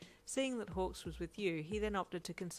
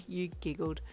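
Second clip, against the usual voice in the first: plain reading voice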